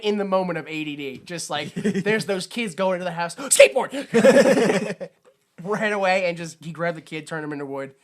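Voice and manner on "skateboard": long stretched loud troll cry, laughing; mock troll cry. Friend acts out the troll spotting a skateboard, stretched laughing yell. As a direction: drag it out like a distracted monster.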